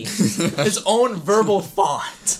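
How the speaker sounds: slow words through laughter; laughing. Real, not acted. Friend laughs at the Canadian man's verbal font. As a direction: laugh out the phrase.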